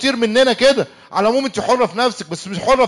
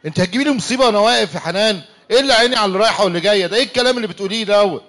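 fast, rushed protest; flustered denial; faster than usual. Acted. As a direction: hurry to deny it, a bit alarmed.

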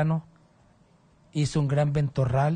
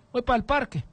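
hermano Luis Leonel García; 8 semitones higher than usual. Acted. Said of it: "como dice él".

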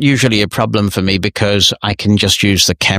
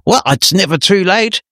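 bright, much higher rising reply; cheery welcome; 11 semitones higher than usual. Real, not acted. Host cheerfully urging a non-member pastor to join, bright and high. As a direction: encourage him to join, lifting the pitch.

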